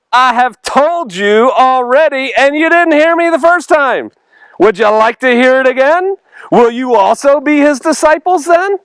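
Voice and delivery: cheeky retort. Acted. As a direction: needle them with a sarcastic repeat. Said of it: "the guy's got cheek".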